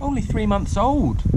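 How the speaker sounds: high, astonished exclamation; awed gasp. A narrator's reading. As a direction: let amazement slip into your voice. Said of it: "gasped Benadonna, trying to keep the awe out".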